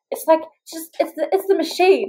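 high-pitched, halting retold answer; imitating herself too strained to explain; struggling to talk. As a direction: stumble for words mid-contraction. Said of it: "I just couldn't talk".

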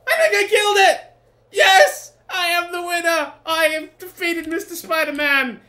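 high, loud victory cry; triumphant. Real, not acted. Player believing he beat the spider, triumphant shouting. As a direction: crow about your win at the top of your voice. Celebrates killing the giant spider.

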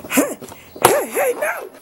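sudden high shout of protest; panicked shout. Real, not acted. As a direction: yell no in a panic. Kind schreit beim Rauswurf.

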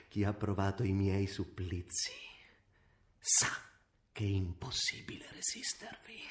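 soft and low, fading to a whisper; menacing whisper. Acted. The king gloats to the inquisitor about his tortures, whispering. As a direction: savour the cruelty quietly.

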